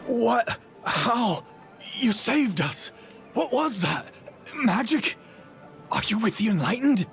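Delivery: stunned by a sudden rescue; astonished. Acted. A stranger to their rescuer after the magic, astonished. Guard amazed at being saved.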